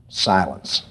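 ordinary talk

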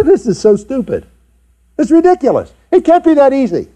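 high, scornful sister's voice, but only lightly put on; mocking sister. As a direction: sneer like a sister who thinks it is nonsense. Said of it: "And your sister mocks you".

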